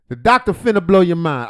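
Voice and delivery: loud, high mock announcement of "The doctor finna blow your mind"; mock hype. Mocking the host's intro.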